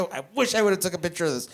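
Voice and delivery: usual speaking voice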